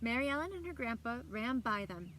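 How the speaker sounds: usual speaking voice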